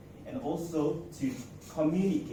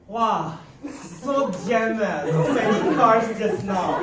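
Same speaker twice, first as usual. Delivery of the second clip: loud, laughing exclaim; 7 semitones higher, 11 dB louder, slower than usual. Retold or imitated. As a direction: laugh through the complaint. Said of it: "my colleague was".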